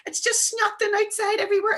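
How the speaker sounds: put-on high-pitched child voice; high, breathless-with-news mock child; excited little boy. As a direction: imitate a wee boy insisting on his big news. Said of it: "teaching a wee boy in P two".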